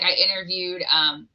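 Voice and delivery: ordinary talk, nothing marked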